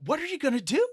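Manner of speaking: loud, very high urgent question; alarmed outcry. Acted. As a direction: cry out in dismay. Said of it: "again, just cries out".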